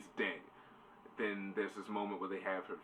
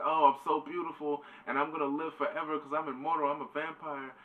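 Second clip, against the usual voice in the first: high-pitched, hammed-up self-adoring voice; vain Lucy; 7 semitones higher, 3 dB louder than usual. Retelling Lucy admiring her reflection, high mock-vain voice. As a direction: play a mock vain vampire, pitched well up.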